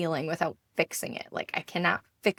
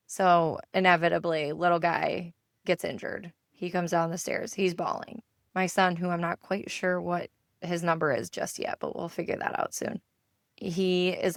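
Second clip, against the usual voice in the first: normal talking voice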